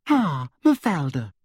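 sugary high-pitched official; sweet, simpering and high; Umbridge voice. A narrator's reading.

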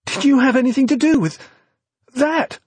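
high, loud and aghast, pointing at the TV; shocked disbelief. A narrator's reading. Evie, horrified, confronts V about the newsreader's death. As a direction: shriek the accusation in disbelief. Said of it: "in shocked disbelief".

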